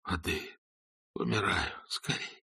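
faint faked dying moan. A narrator's reading. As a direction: groan it out, barely voiced. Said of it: "застонал".

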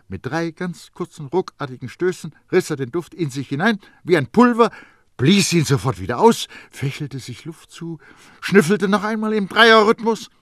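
excited storyteller, pitched high; brisk acted narration. A narrator's reading. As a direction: narrate it urgently in sharp, jerky bursts.

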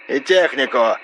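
high voice through a telephone filter; creaky phone voice. A narrator's reading. RS promises equipment, creaky telephone voice. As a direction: squeeze it through the crackly line. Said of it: "проскрипел Р С".

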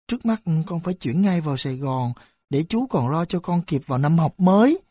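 ordinary delivery

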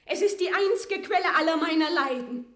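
loud, high cry of grief; loud despairing outcry; anguished lament. Acted. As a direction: wail over her cursed birthright. Maria laments her right.

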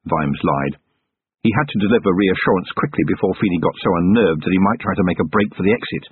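plain reading voice

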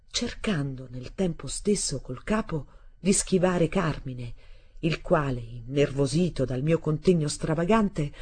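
usual speaking voice

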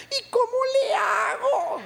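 very high, slow wail; effeminate little voice. Retold or imitated. Preacher imitating the man told not to sway, high effeminate wail. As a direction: imitate him whining helplessly, high and drawn out. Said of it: "con una vocecita".

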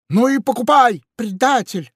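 high, loud angry retort; angry parrot. Acted. Parrot angrily calls the pirate a traitor. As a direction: spit back an insult.

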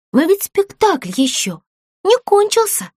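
mildly protesting exclamation; exclaiming. A narrator's reading. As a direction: object with a lift in pitch. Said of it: "воскликнула Бекки". (восклицая)

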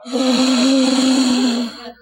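sharp high gasp of panic. Retold or imitated. Preacher acting the lost boy, a panicked gasp. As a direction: gasp like a child suddenly lost. Boy loses sight of mama.

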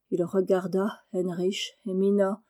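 ordinary delivery

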